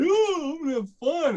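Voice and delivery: high sing-song mock cheer; mock cheerful. Riding the roller coaster, a high mock-cheerful squeal. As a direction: squeal it out like a naive rider.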